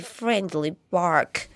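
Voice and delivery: usual speaking voice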